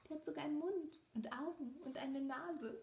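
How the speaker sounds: ordinary delivery, nothing marked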